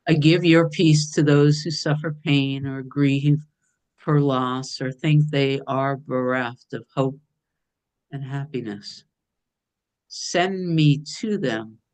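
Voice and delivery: slow, prayerful reading, lifted a little; read very slowly. Shown as a demonstration. Reading the lesson aloud to the group, slow and prayerful. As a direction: let each line of the lesson settle, slowly. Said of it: "I'm gonna read it very slowly".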